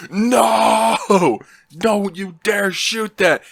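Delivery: long, high screamed no; screaming. Retold or imitated. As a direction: wail a drawn-out panicked refusal, then warn. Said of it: "we're just screaming back and forth".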